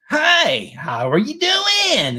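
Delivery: wildly swooping high, loud drawn-out voice; glitching robot greeter with runaway inflection; crazy AI voice. Retold or imitated. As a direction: stretch and soar like a haywire text-to-speech. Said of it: "it gets crazy".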